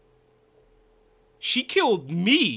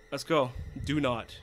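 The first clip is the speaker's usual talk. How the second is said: usual speaking voice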